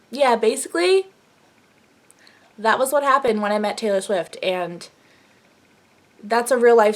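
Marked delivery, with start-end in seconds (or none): none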